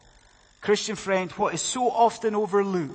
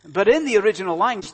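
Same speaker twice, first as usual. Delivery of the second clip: ordinary delivery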